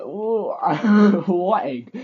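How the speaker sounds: loud, high burst of bafflement with a laugh; mock astonishment. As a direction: exclaim it in exaggerated, laughing disbelief. Acts out his stunned reaction to question.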